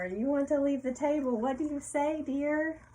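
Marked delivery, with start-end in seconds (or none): none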